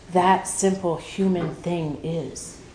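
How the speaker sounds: ordinary talk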